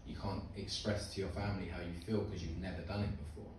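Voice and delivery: ordinary talk, nothing marked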